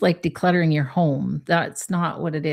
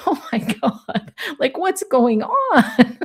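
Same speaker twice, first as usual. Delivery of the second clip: higher, slowed, playful wide-eyed wonder; mock amused surprise; 7 semitones higher, slower than usual. Reader models flipping panic into humor, playfully amazed. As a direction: toss it off like a funny bewildered gasp.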